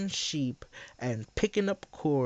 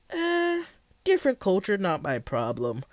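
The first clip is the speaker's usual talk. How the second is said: mocking shrug; 4 semitones higher than usual. Mocking opponent's dismissal.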